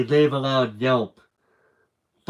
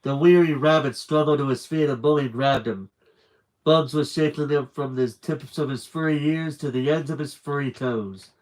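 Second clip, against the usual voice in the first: usual speaking voice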